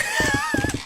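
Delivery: a sudden, very high squeal of laughter; high incredulous laugh. Real, not acted. Laughing at the guy's texts, a high squeal of disbelief.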